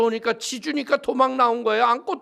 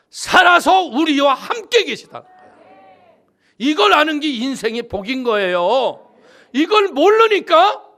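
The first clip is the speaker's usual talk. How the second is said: loud, ringing sermon climax; fervent preaching; 4 semitones higher, 10 dB louder than usual. Acted. Preacher proclaiming God lives to the church, loud fervent preaching. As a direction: preach it out to the congregation.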